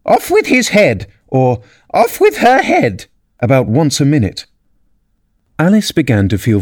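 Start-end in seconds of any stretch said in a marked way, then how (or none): 0.0-1.1 shrill high royal shriek; shouting. A narrator's reading. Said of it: "went stamping about and shouting". 1.9-3.0 shrill high royal shriek; shouting. A narrator's reading. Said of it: "went stamping about and shouting".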